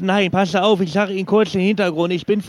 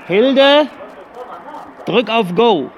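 calling out; 5 semitones higher, much slower than usual. Acted. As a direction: raise your voice to reach someone off the phone. Caller shouts to Hilde offstage.

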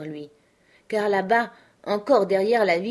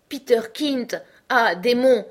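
higher, louder desperate shout; frantic cry; 5 semitones higher, 6 dB louder, slower than usual. Acted. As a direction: fling the name out in horror. Boy screams the ghost's name.

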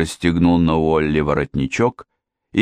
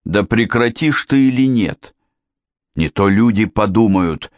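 higher, heated rebuke to daughter; angry scolding; 4 semitones higher than usual. A narrator's reading. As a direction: go at her impatiently. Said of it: "напустился на неё". (сердито напуститься на дочь)

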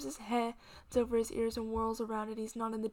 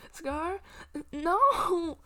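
higher, louder desperate cry; 7 semitones higher, 9 dB louder than usual. Acted. Green crying out as Scar fades, desperate. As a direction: cry out to stop him. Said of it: "he cries out".

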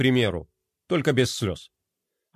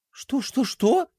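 higher, flustered little princess; 9 semitones higher, slower than usual. A narrator's reading. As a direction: mutter in alarm. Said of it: "пробормотала она". (растерянное высокое бормотание принцессы)

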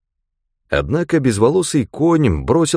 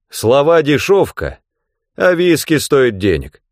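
defiant retort; 6 semitones higher, 3 dB louder than usual. A narrator's reading. Hero answers the threat.

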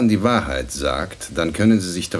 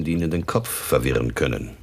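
usual speaking voice

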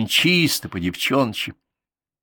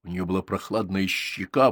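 usual speaking voice

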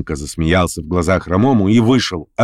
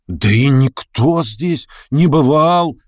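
slow, higher group protest; voices calling out; 6 semitones higher, much slower than usual. A narrator's reading. Voices in the meeting back each other, calling out. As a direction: protest on behalf of everyone. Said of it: "послышались опять голоса".

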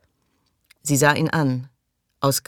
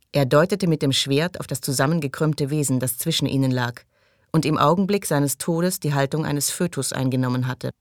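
usual speaking voice

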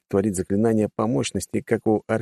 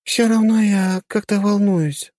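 slow, sighing with worry; worried sigh; 10 semitones higher, 4 dB louder, slower than usual. A narrator's reading. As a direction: say it on an anxious sigh. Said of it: "вздохнула девушка".

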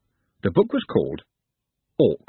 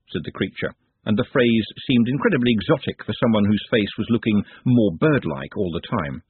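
plain reading voice

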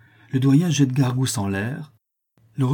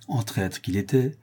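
ordinary delivery, nothing marked

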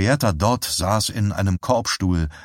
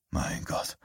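hushed, unvoiced awe; softly; 12 dB quieter than usual. Sheriff seeing the child's room, hushed whisper. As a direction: breathe it out in a whisper. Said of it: "sagte Bannerman leise".

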